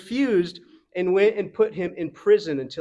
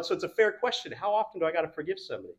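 usual speaking voice

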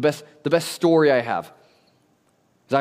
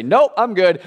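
put-on cocky kid brushing off; 7 semitones higher, 7 dB louder, slower than usual. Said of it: "I say".

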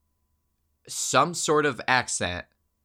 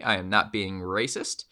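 normal talking voice

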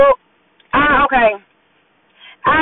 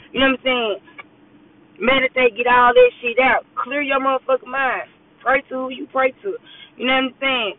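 ordinary delivery

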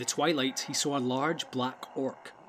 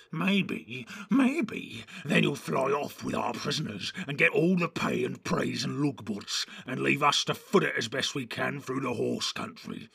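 lightly voiced sceptical orc; complaining orc. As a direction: grumble back as a suspicious orc.

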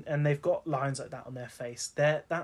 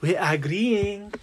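put-on slow, higher Spanish-accented purr; lightly hammed Spanish cat voice; Puss in Boots impression; 6 semitones higher, 8 dB louder, much slower than usual. Mimicking Puss in Boots to tease a co-host in a Spanish accent. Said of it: "That was my Puss in Boots impression".

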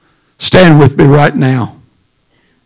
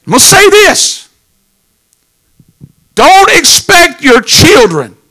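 much higher, building to a shout; preaching shout; 11 semitones higher than usual. Acted. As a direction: call it out over the congregation.

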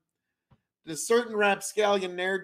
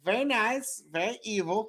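Danhausen impression; 3 semitones higher, 4 dB quieter than usual. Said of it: "Why are you so obsessed with this guy?".

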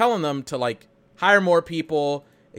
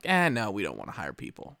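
dismissive managers; 5 semitones lower, 5 dB quieter than usual. Retold or imitated. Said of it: "And they're like".

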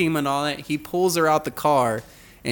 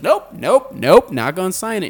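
high, loud, sing-song nope nope nope; stubborn refusal; 5 semitones higher, 6 dB louder than usual. Retold or imitated. Defiant driver refuses to sign the cop's ticket, loud. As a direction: dig in your heels and refuse loudly. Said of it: "she's being real country about it".

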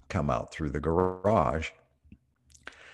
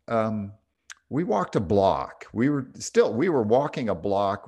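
normal talking voice

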